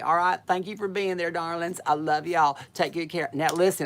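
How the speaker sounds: ordinary talk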